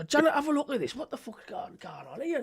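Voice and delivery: soft, high-pitched annoyed muttering; hushed exasperated impression; Big Davey. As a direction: imitate a flustered man grumbling off-mic. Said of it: "you could hear him getting all, all festered and annoyed".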